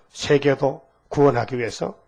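ordinary talk

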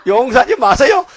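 high laughing "don't forgive"; laughing mock advice. Preacher laughingly mocks advising her not to forgive.

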